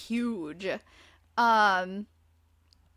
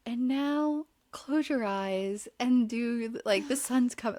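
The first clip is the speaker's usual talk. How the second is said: imitating a calm meditation app; 3 semitones higher than usual. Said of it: "a app that's like".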